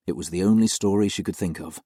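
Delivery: ordinary delivery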